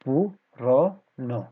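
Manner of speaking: पु-र-न broken into separate slow syllables. Shown as a demonstration. Teacher breaking the word for students, very slow syllables. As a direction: spell out पु, र, न very slowly. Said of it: "किस तरीके से हो जाएगा ये".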